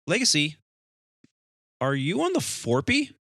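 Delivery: loud, high mock radio query; acted departure controller. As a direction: question the crew as a doubting controller. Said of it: "And departure says".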